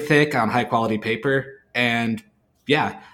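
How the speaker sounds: usual speaking voice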